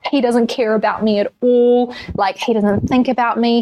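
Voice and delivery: lightly acted hurt; retold crying complaint. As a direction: imitate a mild self-pitying wail. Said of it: "just crying and crying and crying".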